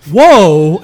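loud, startled "whoa". Retold or imitated. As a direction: blurt it out in shock. Startled reaction to being pointed at.

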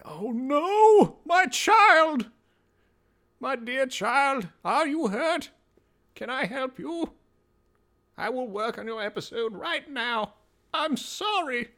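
very high put-on distressed voice; hammed-up, high-pitched fretting over a child; mock-anguished apology. Podcaster apologizing to a forgotten patron, high mock-anguished voice.

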